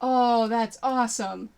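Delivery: ordinary talk, nothing marked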